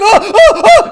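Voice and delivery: mocking the left. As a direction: parody a panicked activist, shrill and over the top. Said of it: "It's like".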